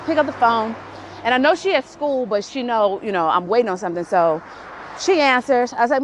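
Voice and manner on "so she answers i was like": usual speaking voice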